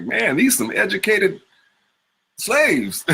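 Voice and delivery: high, laughing disbelief; tossed off with an incredulous laugh; amazed. Retold or imitated. Actor recalls first reading the narratives, amazed and laughing. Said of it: "because I was like".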